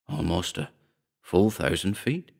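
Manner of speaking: soft, hushed wonder, slowing down; awed whisper. A narrator's reading. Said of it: "he whispered in awe".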